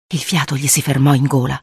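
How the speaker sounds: plain reading voice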